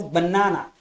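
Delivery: "banana" stressed firmly on the middle NA; stress on second syllable. Shown as a demonstration. Teacher showing how a speaker utters it, middle-stressed "banana". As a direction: punch the second syllable of "banana", then drop. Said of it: "the speaker will say".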